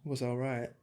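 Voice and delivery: lightly put-on British accent, drifting New Zealand; mock British. Host mimics the guest in British. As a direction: imitate the British guest's line. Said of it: "that was New Zealand".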